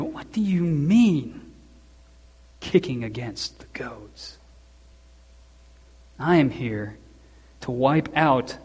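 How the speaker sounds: Saul's zeal fading to a whisper. Retold or imitated. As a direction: let it sink to a hush. Saul's words trailing to whisper.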